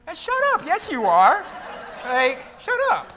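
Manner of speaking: high-pitched comic scolding; mock exasperated retort. Preacher answering the self-excusing critic, high mock exasperation.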